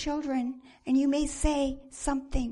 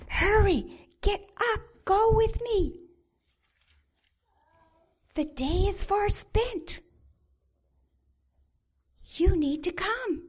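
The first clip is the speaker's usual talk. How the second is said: urgent plea; 7 semitones higher, 4 dB louder than usual. Acted.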